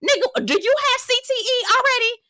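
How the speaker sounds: high-pitched, disbelieving question; incredulous squeal. Retold or imitated. Scolding Shannon, high incredulous squeal. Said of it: "I looked at Shannon and was like".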